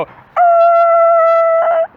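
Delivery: very high, loud mock brake screech like a seal; a piercing falsetto squeal for tires braking; squealing brake noise. Driver imitating his own brakes for the cow, piercing squeal. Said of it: "sounds more like a seal in heat".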